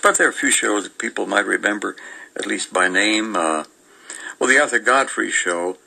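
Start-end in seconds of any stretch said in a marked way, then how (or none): none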